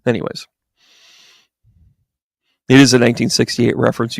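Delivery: usual speaking voice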